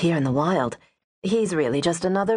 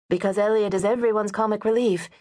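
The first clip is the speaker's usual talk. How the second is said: usual speaking voice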